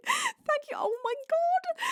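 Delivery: a very high, thin cry of thanks; overwhelmed, squealing gratitude. Real, not acted. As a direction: squeak with giddy disbelief. Overwhelmed by gifts.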